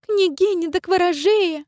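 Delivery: high, slow squeaky mimicry; squeaky mocking voice. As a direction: mimic someone in a shrill squeak. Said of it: "копируя кого-то писклявым голосом".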